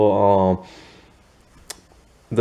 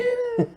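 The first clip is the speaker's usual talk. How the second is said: high laugh; 7 dB quieter than usual. Real, not acted. Laughing about comedic scale.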